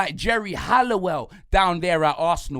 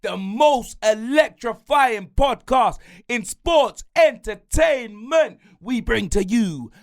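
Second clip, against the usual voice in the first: slow, shouted wrestling-announcer build; announcer welcome; 7 semitones higher, 4 dB louder, slower than usual. Acted. Host opens the episode like a ring announcer. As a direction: stretch out oozing sauce like a ring announcer.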